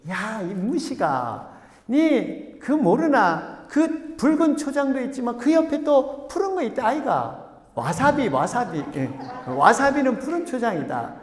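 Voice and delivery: high, drawn-out Gyeongsang dialect; Gyeongsang classmate's scolding. Acted. Classmate scolding his ignorant friend, exasperated Gyeongsang dialect. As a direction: chide with big Gyeongsang pitch swings. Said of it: "옆에 애가 그냥 걔를 탁 뒤통수 치면서".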